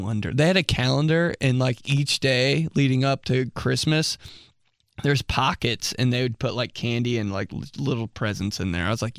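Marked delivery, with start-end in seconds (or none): none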